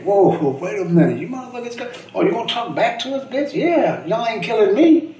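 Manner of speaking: high, loud, incredulous street voice; mock thug outrage. Mimicking the street guys who threatened him, high and loud. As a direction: play the dealers, shocked someone talks back. Voicing the street guys' reaction.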